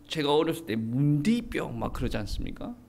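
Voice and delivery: ordinary delivery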